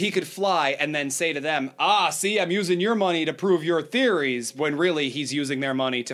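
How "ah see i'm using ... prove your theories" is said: higher, louder mock pitch to the backers; hammed-up salesman reassuring his funders; mock rocketeer. Host voicing the rocketeer to flat-earthers, higher and louder.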